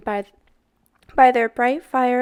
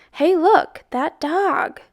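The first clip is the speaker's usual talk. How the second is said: higher, louder cry; excited shout; 6 semitones higher, 5 dB louder, slower than usual. A narrator's reading. A boy spots the dog, calling out excited. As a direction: point and call out.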